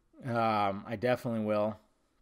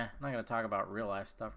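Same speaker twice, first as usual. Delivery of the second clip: ordinary delivery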